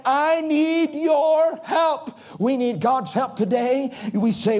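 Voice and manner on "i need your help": pleading cry. Acted. Jabez begging God for help.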